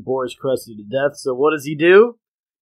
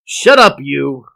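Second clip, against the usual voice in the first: shouting; 8 dB louder, slower than usual. Retold or imitated. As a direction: snap it out at full volume. Homer yelling at conscience.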